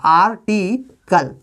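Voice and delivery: Article stretched out, each syllable clear; teacher drawing out article for the class; slow, careful model word. Shown as a demonstration. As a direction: correct article, said very slowly. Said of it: "इसको ये बोलना".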